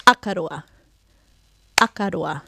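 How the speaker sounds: Akaroa with the first syllable stressed, the rest dropping away. Shown as a demonstration. Teacher showing where the stress is, first-syllable stress on Akaroa. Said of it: "so you can hear with a clap where that".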